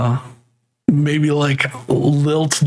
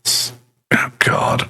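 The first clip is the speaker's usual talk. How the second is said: normal talking voice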